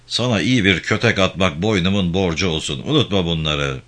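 ordinary delivery

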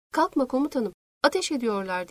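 ordinary delivery, nothing marked

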